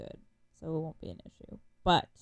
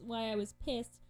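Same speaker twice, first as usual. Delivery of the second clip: ordinary delivery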